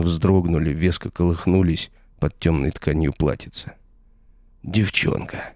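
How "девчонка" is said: startled gasp. A narrator's reading. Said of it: "вздрогнул и ужаснулся Данила".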